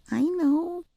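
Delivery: put-on high child's voice; little girl. A narrator's reading. Girl answering.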